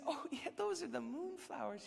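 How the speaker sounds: a hint of Pip's South African accent. Pip explaining the moonflowers, in a light South African accent. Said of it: "she said in her South African accent".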